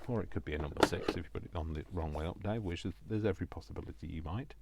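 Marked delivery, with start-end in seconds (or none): none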